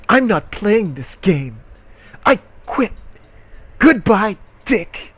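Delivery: defiant quitting. Acted. Reading Christy quitting.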